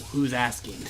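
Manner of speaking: low, soft, lightly voiced noir reply; underplay it, low and quiet; noir tough guy. Dreamer answering a menacing stranger, low noir voice. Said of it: "one of those L A Noire style".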